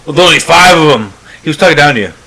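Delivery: ordinary delivery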